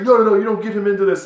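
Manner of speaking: high, loud, rapid acted outburst; fast hammed-up yelling; yelled protest. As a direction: shout them down in a mock fury. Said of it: "He just goes nuts and just yells at him".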